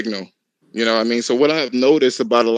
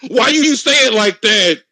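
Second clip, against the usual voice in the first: high, affronted, mocking squeal; high put-on offended voice; mock offended tattler; 8 semitones higher than usual. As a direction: play a scandalized snitch ready to tell. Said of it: "you're like".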